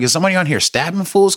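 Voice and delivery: high, fast alarmed inner voice; a quick burst of panic at a scream. Retold or imitated. On the train after a woman screams, retelling a fast alarmed thought.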